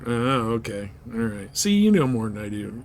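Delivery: ordinary delivery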